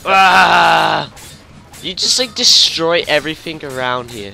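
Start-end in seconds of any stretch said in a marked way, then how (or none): 0.1-1.1 very loud, drawn-out "ah" yell; battle yell. Real, not acted. Player fighting zombies, long battle yell. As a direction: bellow it out long.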